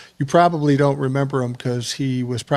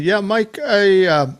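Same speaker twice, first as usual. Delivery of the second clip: put-on Navajo accent; 6 semitones higher, slower than usual. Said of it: "a Navajo accent".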